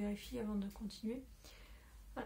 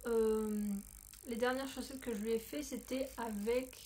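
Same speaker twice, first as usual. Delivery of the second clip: normal talking voice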